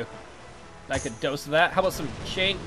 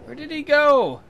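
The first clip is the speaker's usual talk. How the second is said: urgent shout; 10 semitones higher, 7 dB louder than usual. Real, not acted. Losing the ship in battle.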